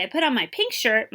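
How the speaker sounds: ordinary delivery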